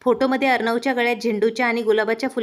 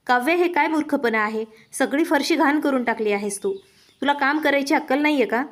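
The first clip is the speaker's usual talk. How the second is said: plain reading voice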